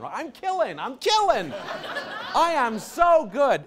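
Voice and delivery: gleeful bragging. Real, not acted. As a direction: crow about it while laughing. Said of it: "whole class screaming".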